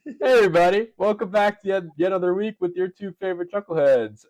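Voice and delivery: high, lively show welcome; upbeat opening greeting to the audience; podcast host intro. Real, not acted.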